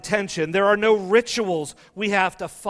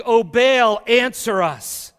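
slow, loud pleading cry upward; calling out to Baal; 4 semitones higher, 8 dB louder than usual. Acted. Preacher voicing Baal's prophets crying to their god, slow loud call. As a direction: call out to a silent god, drawn out and higher.

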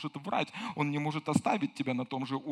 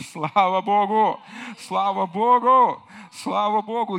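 loud, slow, jubilant cry of praise; joyful praise shout; 3 semitones higher, 10 dB louder, slower than usual. Real, not acted. Preacher shouting praise to the congregation, jubilant and loud. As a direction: shout it out to the church in joy.

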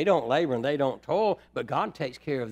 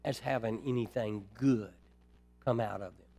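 quiet, lower, slowed reflective preaching; soft pastoral preaching; 5 semitones lower, 6 dB quieter than usual. Acted. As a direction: draw the flock in gently. Quiet intimate preaching passage.

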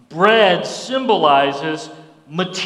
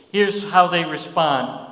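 ordinary delivery

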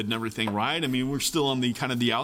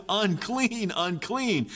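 calling out a warning; 6 semitones higher, much slower than usual. Retold or imitated. Leper warns the crowd away with a high call. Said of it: "he's yelling".

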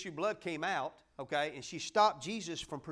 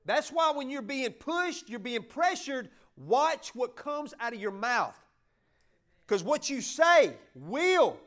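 louder, higher, hammering each point; fiery preaching; 8 semitones higher, 8 dB louder than usual. Acted. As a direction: preach it hard to the congregation. Preaching at full force.

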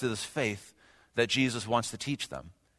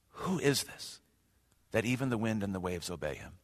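awed hush; 4 semitones lower, 8 dB quieter than usual. Acted. Disciples' awed question.

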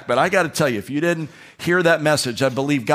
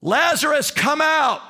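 much higher ringing call; 12 semitones higher, slower than usual. Acted. Preacher voicing Jesus calling Lazarus out, ringing high. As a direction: cry out the name across the tomb.

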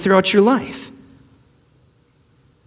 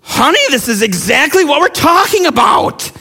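retold angry shout, much higher and sharp; loveless scolding; 7 semitones higher than usual. Father snapping at his daughter, a retold angry shout. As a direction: snap at her without love. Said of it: "without any love at all".

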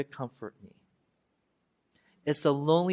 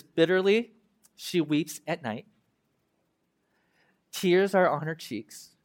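slow, weighty reading of the lament; mournful Bible reading; solemn scripture reading; slower than usual. Acted. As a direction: read each line slowly, letting it sink in. Reads the lament aloud.

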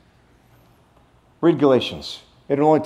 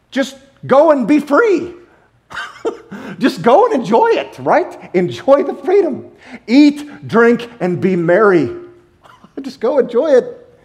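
higher and louder, laughing between lines; excited; 9 semitones higher, 3 dB louder than usual. Real, not acted. Preacher urging the congregation to enjoy freedom, higher and laughing. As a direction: give it with giddy joy, laughing.

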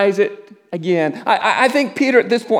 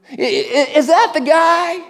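acting out a bystander's sudden, high-pitched recognition; pointed questioning; 9 semitones higher than usual. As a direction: stammer into a high, rising question. Acting out accusers spotting Peter.

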